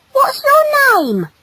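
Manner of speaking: very high, loud, slow question; high-pitched girlish curiosity; eager female. Acted. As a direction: ask with gushing interest. Female eagerly asks his name.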